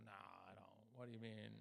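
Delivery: low, soft feigned ignorance; mock innocent mumble. To the loan collector, quiet faked cluelessness. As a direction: play dumb under your breath. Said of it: "And I go".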